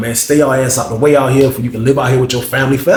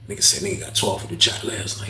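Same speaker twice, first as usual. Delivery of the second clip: softer whispered report; retold whisper; 7 semitones lower, 9 dB quieter than usual. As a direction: whisper the news to a sleeping friend. Waking bro quietly at night.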